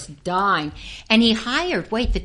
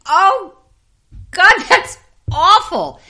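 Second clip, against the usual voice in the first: much higher, louder mock cry; loud appalled outburst; 11 semitones higher, 12 dB louder, slower than usual. Actress reacting to her crying scene in dailies, loud mock dismay. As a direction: blurt it out, high and drawn out.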